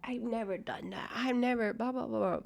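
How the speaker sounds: mocking imitation. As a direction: put on a small mock-denial voice, softly. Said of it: "she's over here like".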